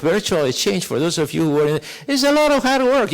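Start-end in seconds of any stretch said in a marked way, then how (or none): 2.1-3.1 high and louder, pressing the point; emphatic. Real, not acted. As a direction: drive home the effort with lift. Stressing effort to audience.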